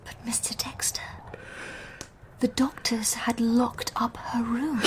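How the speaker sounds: a hushed, careful whisper, slowing down. Acted. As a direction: whisper so you don't set him off. Cautious interruption of a raving man.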